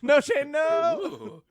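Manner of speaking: high, slow laughing shriek; laughing scream. Pretend. Laughing high drawn-out cry.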